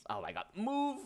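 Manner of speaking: sudden high yelp at the map; startled outburst. Real, not acted. GM startled by something on the map, yelping high. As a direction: blurts out in surprise.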